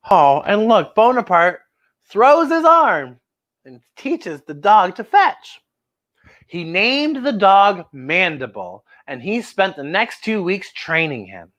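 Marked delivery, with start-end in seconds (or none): none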